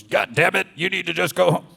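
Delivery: high, loud mock scolding; berating inner critic. The inner critic beating up on the speaker, berating. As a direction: lash out like an angry dad. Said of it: "beating up on me".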